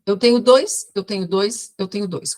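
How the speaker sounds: imitating her echoing reply; repeating three times. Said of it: "Com essa entonação". (imitando a paciente que repete três vezes)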